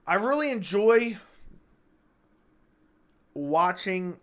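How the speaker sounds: hiccup jolts the voice up; a hiccup mid-phrase. Real, not acted. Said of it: "these hiccups are horrible".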